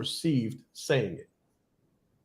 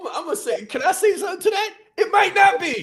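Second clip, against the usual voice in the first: high, squeaky burst building to a shout; excited interjection; 13 semitones higher, faster than usual. Real, not acted. Host interrupting his colleague's example, excited outburst. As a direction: cut in high and fast, bursting to speak.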